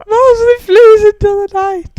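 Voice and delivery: high, loud parody narrator; over-the-top imitation of dramatic narration; mocking high voice.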